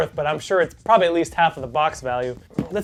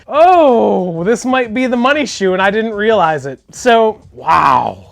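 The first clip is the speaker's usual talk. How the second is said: excited; 3 semitones higher, 7 dB louder, slower than usual. Real, not acted. Finds unexpected money shoe.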